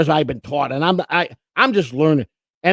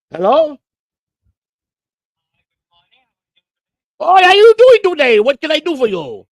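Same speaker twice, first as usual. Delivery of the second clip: mock friendly phone greeting; 6 semitones higher, 6 dB louder than usual. Real, not acted. Answering a scam call, mock friendly.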